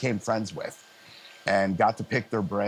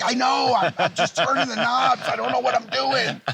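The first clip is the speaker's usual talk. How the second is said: much higher, louder helpless shout, laughing at the end; shouting back; 14 semitones higher, 4 dB louder than usual. Retold or imitated. Young sound guy yells back to drummer he's clueless, laughing shout. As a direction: yell over the band, half laughing at yourself. Yelling back over loud band.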